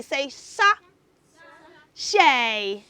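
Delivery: "sa-" then "shay", split and drawn out; teacher modelling "sa-, shay" slowly; syllable by syllable. Shown as a demonstration. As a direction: break the word apart for children to repeat. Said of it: "Say after me".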